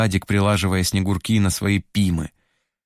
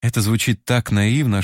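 ordinary delivery, nothing marked

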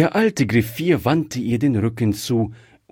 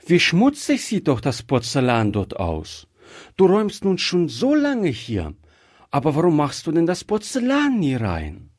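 put-on high child's voice, questioning; curious little girl; 7 semitones higher than usual. A narrator's reading. Gretchen asking the old man about dirty porcelain, high inquisitive child voice. Child figure in read story.

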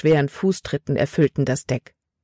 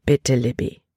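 gentle but firm; 4 semitones lower than usual. A narrator's reading. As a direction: gently insist that she obey. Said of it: "Stimme war sanft". (sanft, aber bestimmt)

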